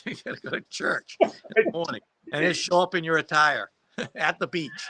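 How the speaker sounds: amused, words broken by laughs; laughing. Real, not acted. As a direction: punch line through real laughter. Laughing at own punch line.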